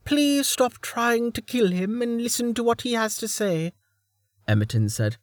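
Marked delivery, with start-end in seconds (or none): 0.0-3.7 pleading. Acted. Emerton pleading in story.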